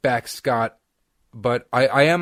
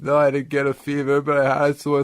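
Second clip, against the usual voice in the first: high, squeezed explanation; pinched, pitched-up voice; weird strained voice; 5 semitones higher, 5 dB louder than usual. Retold or imitated. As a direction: talk as if recovering from a nasal swab. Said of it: "I still have that weird voice".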